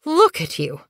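high, fussing flustered mother cat. Acted. Moonflower fussing at dusty Bluekit, high and flustered. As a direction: fret over the messy kit. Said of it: "She sounded flustered".

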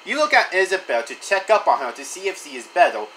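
ordinary delivery, nothing marked

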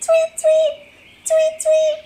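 very high little chirps; bird tweeting. Pretend. Playing the bluebird for children, high tweets. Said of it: "That's a bluebird".

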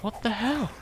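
ordinary talk, nothing marked

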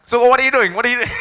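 high, loud mock Indian accent; badly faked Indian manager, laughing through it. Indian manager questioning his absent employee, badly faked high Indian accent. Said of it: "he was an Indian fellow. I can't, I can't do the accent".

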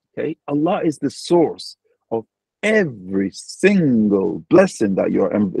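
ordinary delivery

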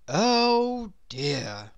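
long-drawn, woeful sigh. A narrator's reading. Mister Tall sighs over his long legs, woeful. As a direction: let it out as a weary lament. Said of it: "he used to sigh".

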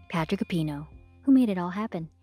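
ordinary delivery

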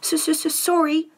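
a big man trying to hold back sobs; sobbing, stammered. A narrator's reading. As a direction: blubber the word out. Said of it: "sobbed Hagrid".

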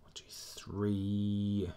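whispered count under the breath; whispering. Real, not acted. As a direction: whisper the numbers.

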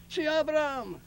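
high yell of the name from downstairs; calling out. Retold or imitated. Building caretaker yelling up to Abraham, high call. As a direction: call up the stairs, high and loud. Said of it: "ele começou a gritar".

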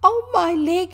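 loud, high yelp of pain; high, loud wail over an injury; pained cry. A narrator's reading. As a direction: cry out as if freshly hurt. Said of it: "he cried".

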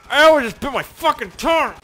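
high, loud pained cry; real hurt from biting tongue; pained yell. Real, not acted. Bit his tongue.

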